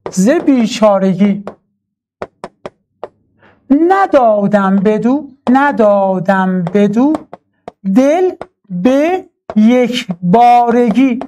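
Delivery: slow, drawn-out syllable by syllable; slow metrical scansion of a Persian verse; scanned poetry recitation. Shown as a demonstration. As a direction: chant the verse out to mark each syllable's length.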